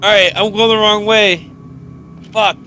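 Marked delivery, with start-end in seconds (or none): none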